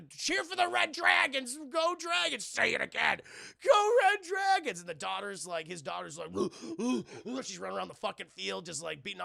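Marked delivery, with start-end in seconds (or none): 0.0-2.4 high, loud, slow team chant; rally the crowd for the Red Dragons; cheering shout. Retold or imitated. Rock cheering at daughter's game. 2.4-4.8 very high, very loud cheer; full yell. Retold or imitated. Cheering even louder.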